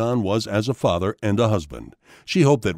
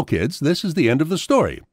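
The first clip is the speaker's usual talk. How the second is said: plain reading voice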